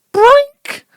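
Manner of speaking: sudden loud, high-pitched "boink"; squeaky jump-scare sound effect; high comic boink. Acted. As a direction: pop it out as a cartoon sound effect.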